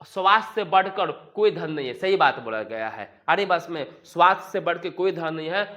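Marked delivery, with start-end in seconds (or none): none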